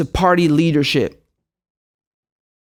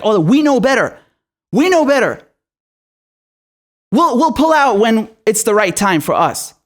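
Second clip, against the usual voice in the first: mocking arrogant voice; 2 semitones higher than usual. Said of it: "they're like".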